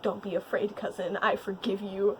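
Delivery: soft, low reassurance; gently forgive a frightened cousin, acted; retold tender forgiveness.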